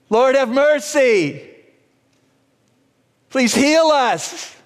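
slow, high-pitched cry for help; urgent yelled plea. Acted. Lepers begging Jesus for healing, yelling urgently. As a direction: call out a short prayer across a distance. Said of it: "they yelled".

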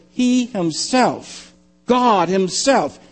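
very slow, weighty "He himself", then "God himself"; stress on HIMSELF. Shown as a demonstration. Preacher unfolding the original language, emphatic. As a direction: draw out each "himself" with emphasis. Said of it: "The emphasis on the one who makes the statement".

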